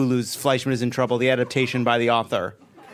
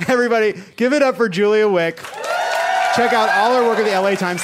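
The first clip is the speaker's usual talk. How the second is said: loud, raised applause call; show host announcer; 8 semitones higher, 4 dB louder than usual. Acted. Host asking the crowd to applaud a guest, loud announcer. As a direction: send the guest off, pitched up and loud.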